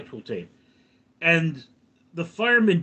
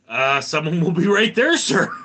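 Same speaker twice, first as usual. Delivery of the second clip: higher, louder, brisk mock dispatcher with a laugh; dispatcher on the phone; 5 semitones higher, 7 dB louder than usual. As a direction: play the 911 operator, chipper and loud. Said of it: "And they say".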